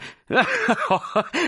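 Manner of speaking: high, acted chuckle of reminiscence; high-pitched, delighted laughter; hearty laugh. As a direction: laugh fondly at old schooldays. Thin man laughs over schooldays.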